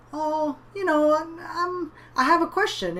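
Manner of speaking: put-on hesitant small boy working up courage; little boy voice. Young brother asking the clown a question, high and shy.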